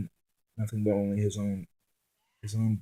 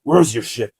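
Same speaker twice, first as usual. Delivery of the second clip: demanding; 10 dB louder than usual. Acted. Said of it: "and asked".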